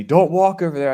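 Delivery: high, loud mock yell; retold yelling. Lady warning a shopper off a spill, retold yelling. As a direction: imitate an angry lady shouting a warning. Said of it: "yelling at me".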